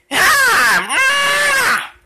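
high, drawn-out effort cry; flutter-jump yell. Shown as a demonstration. Yoshi showing T-Man a flutter jump, high drawn-out yell. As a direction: yell upward as if leaping.

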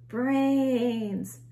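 loud zombie call; zombie cry. A narrator's reading. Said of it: "cried Reginald".

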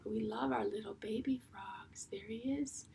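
put-on tender whisper about the baby frog; teacher whispering to the children about the baby frog. Shown as a demonstration. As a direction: whisper as if not to wake him.

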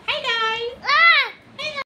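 put-on squeaky baby voice. Shown as a demonstration. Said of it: "बोलो हाय guys".

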